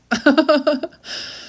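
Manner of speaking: high burst of embarrassed laughter. Real, not acted. Reading affirmations on camera, a high embarrassed laugh. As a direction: laugh it off after getting teary.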